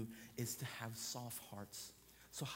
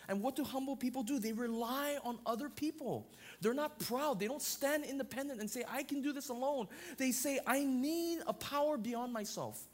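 louder, insistent preacher's exhortation; full-force preaching; 6 semitones higher, 4 dB louder than usual. Acted. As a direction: drive it home to the congregation.

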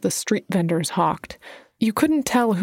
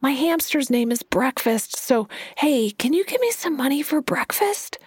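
high and breathy; 6 semitones higher than usual. A narrator's reading. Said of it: "his voice high and breathy".

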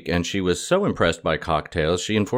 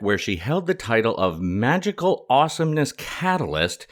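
ordinary delivery

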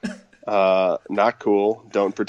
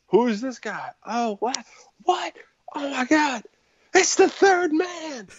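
much higher, shrieking wrestling announcer; excited commentator. Retold or imitated. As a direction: scream the shock of the big reveal. Retelling a wrestling surprise entrance.

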